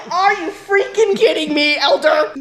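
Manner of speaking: loud, high indignant outburst; mock exasperation. Missionary answering the district leader, mock-indignant. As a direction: protest in disbelief, half laughing.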